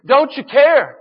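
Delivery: frightened disciples shouting over the storm; desperate cry. Acted. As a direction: cry out in panic to the sleeping Lord. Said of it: "the disciples cried out".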